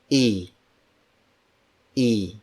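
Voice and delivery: I said as "ee"; Filipino I. Shown as a demonstration. Teacher models the letter I for learners, "ee". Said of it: "For the letter I".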